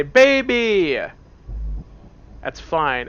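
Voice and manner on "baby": put-on "Baby!" yelled high and loud; shouted word. Man imagines yelling "Baby!" at a kindergarten, shouting. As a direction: yell "Baby!" into the room. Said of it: "I say".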